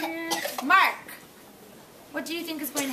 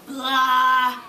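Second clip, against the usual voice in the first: grumpy blah; 8 dB louder than usual. Acted. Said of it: "said a voice from inside".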